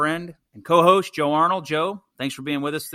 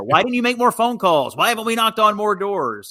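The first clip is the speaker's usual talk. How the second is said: retold scolding, higher and louder; berating boss; 8 semitones higher, 6 dB louder than usual. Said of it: "being berated by our bosses".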